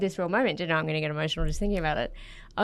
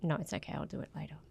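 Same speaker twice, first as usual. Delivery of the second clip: softer, trailing fake okay; passive brush-off; 12 dB quieter than usual. Wife retelling her own coded brush-off, soft and trailing. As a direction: let it fade into a resentful "fine".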